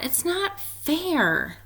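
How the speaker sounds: whiny complaint. Acted. Splat groans in defeat.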